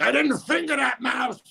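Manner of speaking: high, loud panicked denial; panicked yell. Retold or imitated. Comic retelling a panicked denial to HR. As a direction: blurt it out in a shocked panic.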